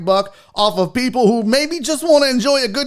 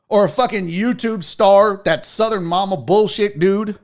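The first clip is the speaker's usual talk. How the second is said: ordinary talk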